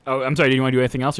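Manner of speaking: normal talking voice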